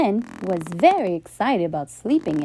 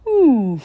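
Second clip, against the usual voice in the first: sniff; 4 semitones higher, 7 dB louder than usual. Acted. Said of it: "sniffed Walrus".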